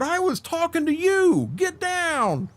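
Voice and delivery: high, hollered retold order; high-pitched mock bawling-out; drill sergeant yell. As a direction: imitate a sergeant pouncing on a recruit. Drill sergeant singles him out, acted.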